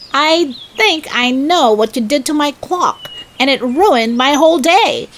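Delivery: high, huffy and aggrieved. A narrator's reading. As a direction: let him know you're hurt. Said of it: "harumphed Rabbit".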